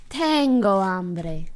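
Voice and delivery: drawn-out, slowed "Tengo hambre"; slow imitation. Learner echoing the slow translator, drawn-out imitation. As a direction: draw each syllable of "Tengo hambre" right out. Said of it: "I gotta do a so too".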